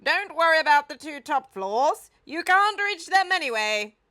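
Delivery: loud, high call across the garden. A narrator's reading. The Duke calls out to the gang. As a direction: call out to stop them. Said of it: "The Duke called out".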